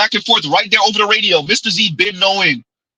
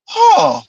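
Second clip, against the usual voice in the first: high cry of a laugh; 11 semitones higher, 3 dB louder than usual. Real, not acted. Reacting after rant.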